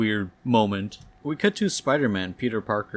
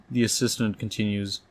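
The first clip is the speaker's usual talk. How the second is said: plain reading voice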